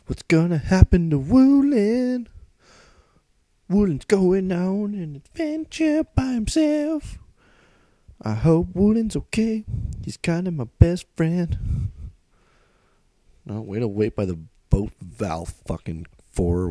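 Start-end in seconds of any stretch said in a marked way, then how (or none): 0.1-11.9 much higher, slow, sing-song fretting; mock worried kid. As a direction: play a fretful child worrying over a friend. Mock worry about game character.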